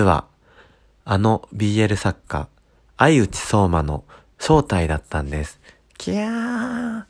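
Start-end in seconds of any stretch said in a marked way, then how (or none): 6.0-7.0 high drawn-out squeal; mock fan squeal. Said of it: "え、嘘っていう声がね".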